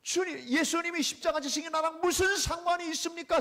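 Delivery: high, pleading, tear-filled question; tearful cry. Retold or imitated. Friend in tears crying his question to the Lord, tearful. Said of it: "울고".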